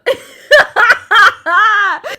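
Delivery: loud high real laugh; burst of laughter. Real, not acted. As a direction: crack up loudly. Laughs at own trauma remark.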